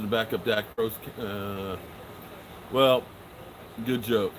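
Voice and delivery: normal talking voice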